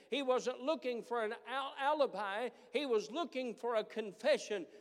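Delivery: loud, high, driving pulpit preaching; preacher pressing home that God loves you; full-force preaching. Acted. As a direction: preach it out and lift the whole room.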